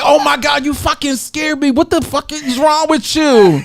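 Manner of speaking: high, shocked mock scolding. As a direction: complain like you just got spooked. Husband scolding after the fright.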